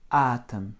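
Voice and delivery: "Атом" stressed on А, the о shrunk to a faint uh; O almost E. Shown as a demonstration. Teacher showing O is almost an E, "Атом" with reduced O. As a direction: say "Атом" with a strong first syllable, second nearly gone. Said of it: "C'est presque un E".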